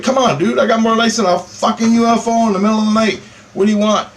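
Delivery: high, indignant retort to the other driver; mock indignant reply; exasperated comeback.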